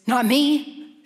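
mock arrogant Peter scoffing "Not me.". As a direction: brush it off as proud Peter. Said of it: "I love Peter's arrogance".

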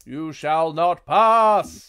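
a loud, drawn-out wizard blocking the door; Saruman. Acted. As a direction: bar the way like a mighty wizard.

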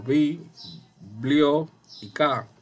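slow, separated syllables with pauses between. Shown as a demonstration. Teacher sounding out biblioteca for the class, syllable by syllable. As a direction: break "bi, blio y ka" into deliberate beats. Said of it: "las que tienen menor fuerza".

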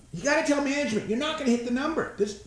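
usual speaking voice

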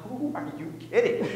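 scoffing through a laugh. Retold or imitated. Rafter mocking the safety video, laughing scoff. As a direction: brush it off with a disbelieving chuckle.

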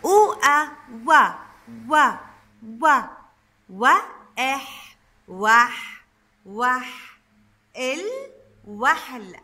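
"وحل" built up slowly from "و", "وح" and "ال" into the whole word; teacher sounding out "وحل" for children to repeat. Shown as a demonstration. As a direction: chant "و" several times, then "وح", "ال" and finally "وحل". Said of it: "خلينا نقرأ مع بعض الكلمة".

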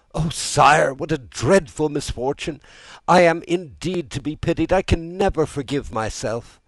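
high, loud anguished lament; distraught, self-reproaching outcry; despairing. A narrator's reading. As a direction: wail it in despair to the king. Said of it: "giving way to an impulse of despair".

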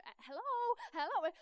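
high, loud searching hello; retold calling out. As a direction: call into an unknown place for attention. Calling out in unfamiliar garage.

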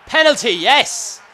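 loud, high celebration; real burst of delight; excited cheer. Real, not acted. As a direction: cheer the awarded penalty. Awarded a penalty.

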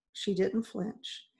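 plain reading voice

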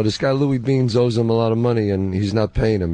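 normal talking voice

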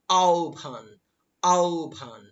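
আহ্বান said "ao-bhan", long first glide; correct pronunciation. Shown as a demonstration. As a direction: sound out আহ্বান as drawn-out "aao-bhaan". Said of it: "আহ্বান শব্দের উচ্চারণ হবে".

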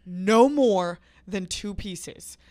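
slow, loud, every word weighted; stern warning. Retold or imitated. Man handing over chocolate, warning them sternly. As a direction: lay down the rule slowly and loudly.

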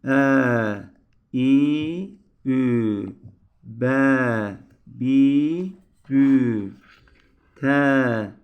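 loud, spaced syllable drill; reciting syllables. Shown as a demonstration. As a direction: call out each sound for the class to copy. Teacher drills letter sounds.

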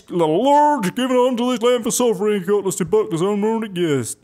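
high, loud mock cultist preacher; hammed-up religious voice. Player reading out the cultists' claim, hammed-up preaching voice. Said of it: "continued reading that in a normal voice".

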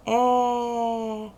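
Е held long, loud and steady; isolated vowel. Shown as a demonstration. Teacher isolating a vowel for pupils, Е held long. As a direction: sustain a loud Е on one note. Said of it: "голосний".

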